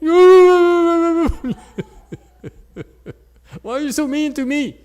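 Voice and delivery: imitating a hurt student. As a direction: play the wounded student pleading. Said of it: "if I shouted at her, what would she do?".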